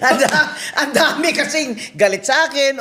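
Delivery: words spilling through a laugh. Real, not acted. Joking about her haters, talking through a laugh. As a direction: say it while still laughing.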